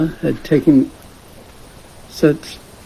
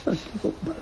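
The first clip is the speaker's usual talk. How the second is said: Shakshi Gopal said the Bengali way, pitched up and soft; Bengali accent; 9 semitones higher, 16 dB quieter than usual. Shown as a demonstration. Teacher answering her own question in a soft Bengali accent. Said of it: "with a proper Bengali accent".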